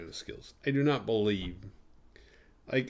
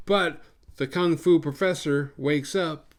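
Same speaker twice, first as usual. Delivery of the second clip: ordinary delivery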